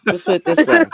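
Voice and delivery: laughing through her catchphrase; laughing imitation. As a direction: mimic her while laughing.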